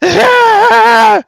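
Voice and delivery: high, loud sustained scream; full-volume high shriek; startled shout. Acted. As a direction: scream in mutual shock. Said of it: "they're both kinda shouting".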